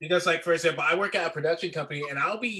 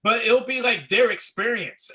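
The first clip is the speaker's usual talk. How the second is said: higher, louder and slower in disbelief; a real rant, raised and drawn out; exasperated; 7 semitones higher, 6 dB louder, slower than usual. Real, not acted. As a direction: stretch it out, fed up. Exasperated complaint about advice.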